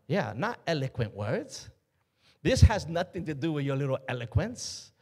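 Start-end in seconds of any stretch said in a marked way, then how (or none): none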